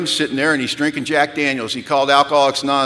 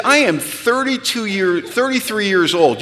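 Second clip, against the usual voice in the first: slow, high, emphatic protest; mock-offended declaration; indignant retort; 5 semitones higher, slower than usual. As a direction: stand on your age, word by emphatic word. Indignant retort to sponsor, acted.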